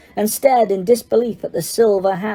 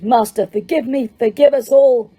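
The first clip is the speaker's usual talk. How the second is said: mild mock shriek, rising higher and louder at the end; shrieking plea; 5 semitones higher, 3 dB louder than usual. A narrator's reading. Death Eater begs Voldemort's forgiveness, a rising mock shriek. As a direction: beg for mercy with a rising cry. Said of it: "he shrieked".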